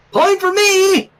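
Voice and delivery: gleeful shout of victory; excited exclamation. A narrator's reading. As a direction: crow it out like she just won. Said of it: "Why does she exclamation point?".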